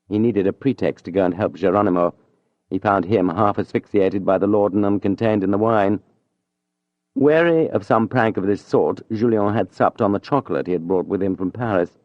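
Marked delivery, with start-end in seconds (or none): none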